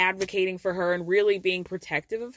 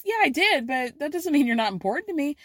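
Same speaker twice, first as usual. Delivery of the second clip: mock eager defense; 6 semitones higher, faster than usual. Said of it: "And Linda goes".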